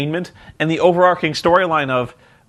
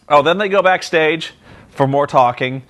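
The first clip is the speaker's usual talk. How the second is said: normal talking voice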